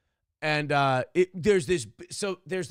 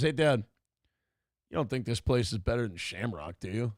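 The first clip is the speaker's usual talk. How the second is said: put-on softer, smug kid voice; smirking kid; 5 semitones lower, 3 dB quieter than usual. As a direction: slyly needle dad. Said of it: "he smirks".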